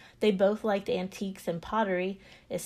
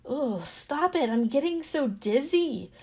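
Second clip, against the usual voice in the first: mild storyteller's scream, pitched up; screamed complaint; 4 semitones higher than usual. A narrator's reading. Dizzy clay to the master spinning it, mildly screamed protest. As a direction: plead to make the spinning stop. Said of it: "I screamed".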